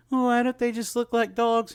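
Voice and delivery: high child's whine, imitating her kid. Parent imitating her child's puzzled question in a high voice. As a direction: mimic a little kid asking, puzzled and high. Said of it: "she's like".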